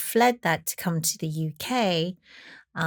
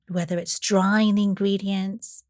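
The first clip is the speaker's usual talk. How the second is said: normal talking voice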